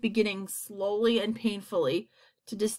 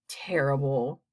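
low-pitched dismay; dismayed creature; 6 semitones lower, slower than usual. A narrator's reading. Another creature atop the peach, low dismay. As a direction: pronounce it low, as another character.

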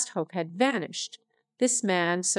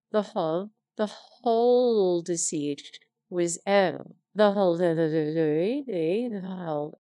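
stumbling reread. Real, not acted. Reader flubbing the line, stammering and halting. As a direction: trip over the words and repeat.

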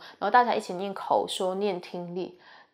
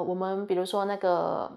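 ordinary talk, nothing marked

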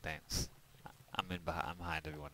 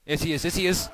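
being chased; 6 semitones higher, 6 dB louder than usual. Real, not acted.

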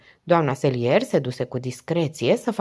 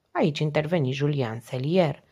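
plain reading voice

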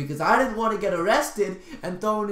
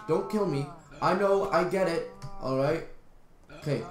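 usual speaking voice

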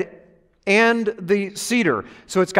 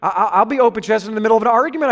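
normal talking voice

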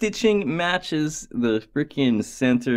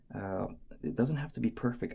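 ordinary talk

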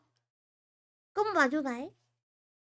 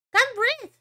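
"Can't breathe." higher and louder, whining; louder sick whine; whiny repeat; 6 semitones higher, 4 dB louder than usual. Real, not acted. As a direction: complain it again with a groan.

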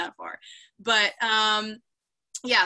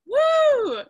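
hoge, luide juichkreet; excited woo; 5 dB louder than usual. Real, not acted. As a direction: juich het uit van plezier. Cheering about making desserts.

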